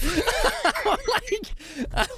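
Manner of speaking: squealing high-pitched laugh. Real, not acted. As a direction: burst into a high giggle. Laughing at own joke.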